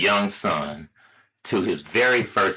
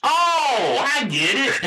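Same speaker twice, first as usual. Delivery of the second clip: high-pitched mock dawning; squeaky teasing impression of late understanding; belated realization; slower than usual. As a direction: imitate a slow friend finally getting it, pitched way up. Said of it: "J-Rod's gonna be like".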